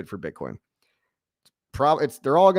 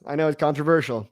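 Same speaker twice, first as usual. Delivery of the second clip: ordinary talk, nothing marked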